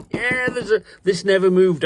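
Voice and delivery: excited burst. Real, not acted. Laughing reaction to the box.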